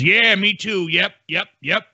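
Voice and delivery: high, mocking "me too" voice; mock accuser voice.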